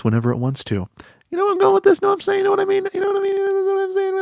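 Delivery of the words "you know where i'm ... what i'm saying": very high, squeaky repeated questions; squeaky babble. Pretend. Host pestering listeners for agreement, squeaky high. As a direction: keep squeaking out the check at the top of the voice. Comic run of repeated checks.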